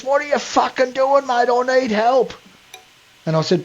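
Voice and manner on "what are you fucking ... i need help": high, strained mock voice of the panicking driver; mimicking the stuck bloke. Retelling the stranger on the beach in a high panicked mock voice. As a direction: pitch way up to play the desperate stranger. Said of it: "He says".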